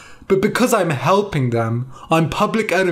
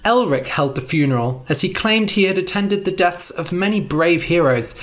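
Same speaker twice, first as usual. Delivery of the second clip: usual speaking voice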